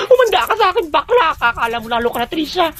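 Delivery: high-pitched, hammed-up outrage; furious threat. Insulted bully threatening Trisha, high furious outburst. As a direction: threaten your rival in a high, worked-up voice.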